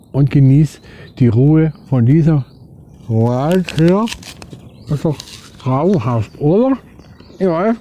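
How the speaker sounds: talking with a mouth full of apple; words round a bite of apple. Real, not acted. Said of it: "mit vollem Mund spricht man nicht".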